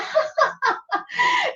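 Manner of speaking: very high, breathy forced laugh; showy fake giggle. Shown as a demonstration. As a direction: burst into a put-on laugh. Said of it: "so just go".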